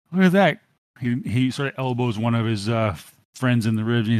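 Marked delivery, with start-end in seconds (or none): 0.1-0.6 high, loud sneering gasp; mocking thug. Thug jeering to his buddy, high and loud. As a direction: jeer it out at her. Thug mocks her advances.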